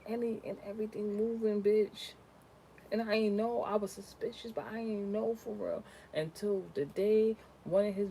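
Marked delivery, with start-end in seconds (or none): none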